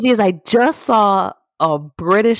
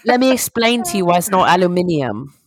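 imitating a snooty Brit, higher; mock British accent; 4 semitones higher than usual. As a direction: put on a prissy British accent. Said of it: "British people say that shit like".

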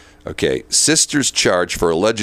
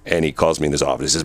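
ordinary delivery

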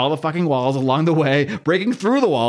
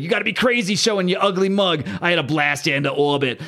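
Thing voice; 4 semitones higher than usual. Retold or imitated.

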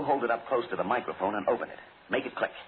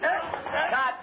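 calling cut. Real, not acted. Director stopping rehearsal.

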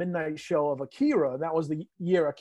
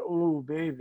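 sexy robot voice; 4 dB quieter, slower than usual. Retold or imitated. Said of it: "Sexy robot voice that he would always be into".

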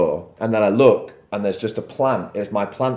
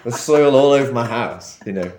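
normal talking voice

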